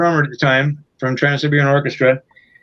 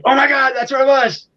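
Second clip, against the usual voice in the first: high, bright-eyed cry of relief; excited discovery; 9 semitones higher than usual. Retold or imitated. Spotting the lost tour bus at last, high and excited. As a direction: burst out at the sight.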